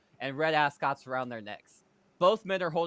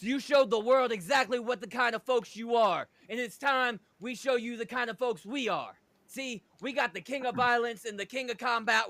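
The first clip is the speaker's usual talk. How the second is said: wrestling promo; 6 semitones higher than usual. Acted. Rick Rude calls out La Resistance, cocky promo. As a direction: cut the promo like a cocky strongman. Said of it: "Rick says".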